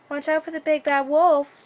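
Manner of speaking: high, loud warning cry from the mother; mother cries out. Acted. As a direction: call a frantic warning after the children. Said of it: "she cried".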